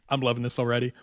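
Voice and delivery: ordinary talk, nothing marked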